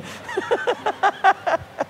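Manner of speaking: a burst of high laughter. Real, not acted. Preacher laughing at the boy's question, high.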